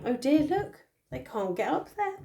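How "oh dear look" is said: ordinary delivery, nothing marked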